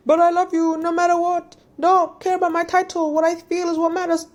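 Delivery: hammed-up hero insisting on his love; mock ardent hero.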